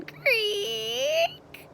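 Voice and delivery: high, drawn-out creak; imitated creak. A narrator's reading. Reader imitates a trap door for the story, high creak. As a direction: squeal like an opening trapdoor.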